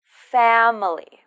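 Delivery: FA-mily, falling tune; stress first syllable. Shown as a demonstration. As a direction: push "Fa" in "Family". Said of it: "we stress the first syllable".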